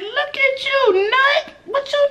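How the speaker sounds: very high, slow, whiny mock scolding of herself; high squeaky self-scolding. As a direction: squeal it at yourself like a shocked friend. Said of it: "I'm like".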